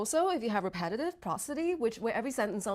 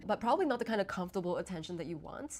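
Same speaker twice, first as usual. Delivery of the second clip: usual speaking voice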